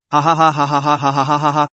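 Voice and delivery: fast, wild burst of laughing; wild laughter. A narrator's reading. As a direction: laugh wildly, rapid ha ha ha. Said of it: "Wang Yunxiao laughed, laughing wildly".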